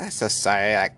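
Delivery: imitating a gushing apology; mock-apologetic lover; apologetic girlfriend. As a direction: play a crazy girlfriend saying sorry. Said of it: "she's so apologetic".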